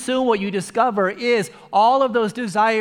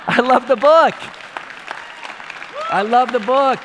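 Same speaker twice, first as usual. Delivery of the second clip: excited convert; 3 semitones higher, 3 dB louder than usual. Retold or imitated.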